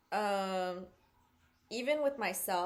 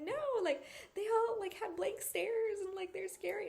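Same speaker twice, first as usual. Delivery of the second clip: much higher-pitched imitated protest; protesting friend; 12 semitones higher than usual. Retold friend describing scary blank stares, protesting. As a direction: insist in a high, alarmed retelling.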